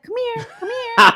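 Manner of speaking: high-pitched coaxing to the cat; high coaxing call. Retold or imitated. Coaxing her runaway cat in a high call. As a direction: coax him in high up.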